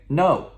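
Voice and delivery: NO high and loud, falling firmly; loud sharp no. Shown as a demonstration. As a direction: hit "No" in a big downward voice. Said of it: "Your loud voice".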